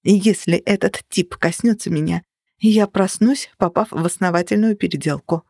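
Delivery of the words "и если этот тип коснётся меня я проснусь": ordinary delivery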